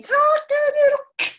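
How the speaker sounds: very high, loud, slow cock-a-doodle; rooster's crow choked off mid-warning. Acted. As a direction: crow it like a rooster cut short. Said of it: "tried to crow a warning".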